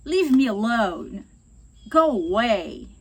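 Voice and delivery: loud, slow and curt; rude dismissal. Shown as a demonstration. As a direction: push the person away with your voice. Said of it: "nos filmes a gente ouve falar direto".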